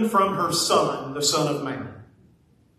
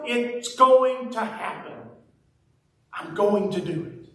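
preacher voicing Jesus with rising conviction; Jesus resolute; 5 semitones higher than usual. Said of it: "maybe Jesus is saying".

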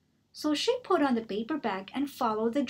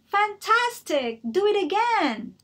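excited shout; 10 semitones higher, 7 dB louder than usual. Acted. Elizabeth egging the dragon on, shouting. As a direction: cheer him on to go again. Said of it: "Elizabeth shouted".

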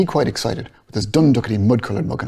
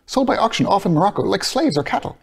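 higher, building passionate protest; impassioned Bloom; 10 semitones higher than usual. Acted.

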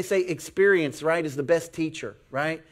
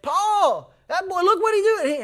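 high-pitched alarmed holler; 13 semitones higher, 4 dB louder than usual. Acted. Uncle hollering to dad about the boy, alarmed. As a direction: call out urgently to someone nearby. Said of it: "He hollered at my dad".

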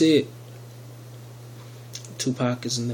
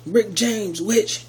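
higher, louder [name] brag, hammed up; loud [name] boast; 7 semitones higher, much slower than usual. Retelling the skit, a louder mocking [name] brag. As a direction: belt the catchphrase like the skit. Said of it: "when he would say".

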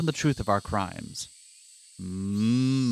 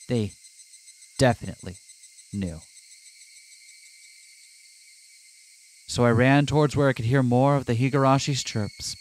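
plain. Acted. Reads game narration aloud.

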